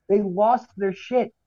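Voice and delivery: ordinary delivery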